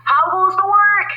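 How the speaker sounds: high, loud farmer call. Acted. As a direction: bellow it as the lazy farmer. Farmer calls from bed.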